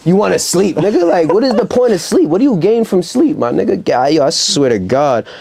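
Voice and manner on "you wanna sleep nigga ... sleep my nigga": high, mocking interrogation; mock anger. Quizzing a sleeper on the point of sleep, high mock anger. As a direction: grill them in a high mock rant. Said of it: "I really get mad with that shit".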